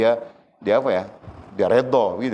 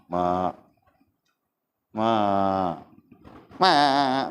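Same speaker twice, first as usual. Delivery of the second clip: put-on bleating like a goat kid; much slower than usual. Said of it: "macam anak kambing ni". (panggil mak macam anak kambing mengembek)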